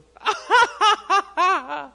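loud, high-pitched burst of laughter; imitated grandpa laugh. Preacher mimicking the grandpa who just starts to laugh, loud laughing. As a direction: act out a big sudden laugh. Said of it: "and then just goes".

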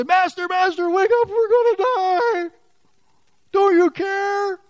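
high, loud frantic pleading; panicked cry. Acted. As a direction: beg him to wake up, terrified. Panicking disciples wake Jesus.

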